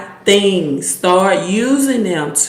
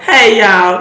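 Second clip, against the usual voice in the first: loud Mississippi greeting; hearty, loud Southern drawl; Southern accent; 3 semitones higher, 7 dB louder than usual. Real, not acted. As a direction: let a Mississippi accent slip out. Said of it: "that Southern accent came out then".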